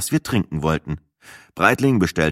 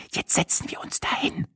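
hushed whisper, higher; 11 semitones higher, 7 dB quieter than usual. Acted. Sina whispers to her boyfriend, hushed whisper. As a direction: whisper it conspiratorially. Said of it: "tuschelte Sina".

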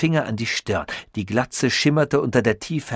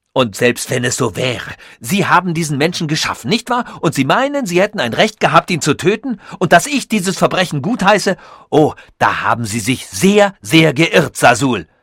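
louder, quick, indignant reproach; angry accusation; 5 semitones higher, 5 dB louder than usual. Acted. Tichy accuses Sasul of killing his copy, indignant and louder. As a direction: fire the accusation at him.

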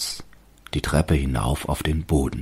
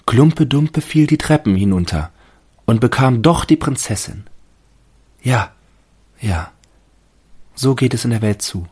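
high, loud, wondering tree voice; fir tree musing; 8 semitones higher, 4 dB louder than usual. A narrator's reading. Fir tree believes the tale, high naive wonder. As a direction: marvel at the story naively. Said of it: "dachte der Tannenbaum".

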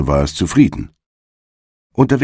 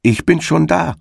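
entgegengerufen; 11 semitones higher than usual. A narrator's reading. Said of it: "rief ihm die Frau des Igels entgegen".

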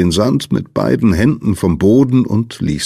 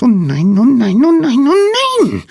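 very high, loud little squeal; squeaky panic; 5 dB louder than usual. A narrator's reading. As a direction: pipe it frantically at the top of the voice. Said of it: "piepste jemand". (sehr hohes, lautes Piepsen)

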